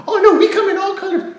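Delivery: high, loud, laughing mock-sweet voice; sing-song laughing impression; mocking commenter. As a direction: parody a preachy comment.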